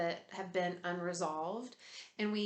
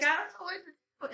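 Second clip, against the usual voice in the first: mock helpless cry, higher and louder; 8 semitones higher, 5 dB louder than usual. Grieving person flooded with grief, mock helpless and louder. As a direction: play someone lost and overwhelmed. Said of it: "then we're like".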